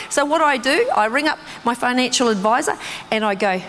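normal talking voice

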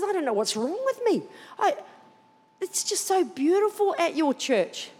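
high, plaintive bewilderment; lightly acted weepy wonder; imitating tearful mother. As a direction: retell a mother overcome with emotion. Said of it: "She's crying at".

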